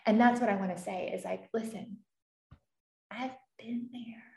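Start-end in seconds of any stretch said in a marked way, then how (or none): 1.5-4.2 soft, slow, half-whispered comfort; a lightly voiced hushed reassurance; tender reassurance. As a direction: tell them gently you've been there. Her own message to struggling people.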